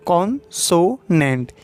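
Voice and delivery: kon-so-nent drawn out slowly, syllable by syllable; stretched teaching demo of consonant. Shown as a demonstration. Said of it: "ये बोलते हैं syllable".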